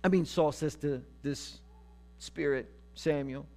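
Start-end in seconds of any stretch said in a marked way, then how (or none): none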